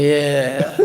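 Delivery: hammed-up blissed-out yeah; mock stoned yeah. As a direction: sink into a lazy stoned sigh. Stoned friends enjoying music.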